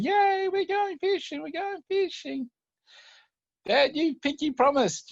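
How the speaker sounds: put-on high, slow, gleeful child's cheer; cheering little boy. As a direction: sing it out like a kid on the best morning. Boy cheering on fishing day.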